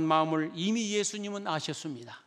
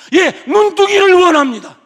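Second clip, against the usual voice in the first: much louder, higher cry; eager loud answer; 12 semitones higher, 18 dB louder than usual. Acted. Blind beggar answering Jesus that he wants to see, loud and eager. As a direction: blurt the wish out.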